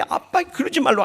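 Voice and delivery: high-pitched imitation of a daughter; daughter's protest. Preacher voices daughter stopping her kneeling father, high protest.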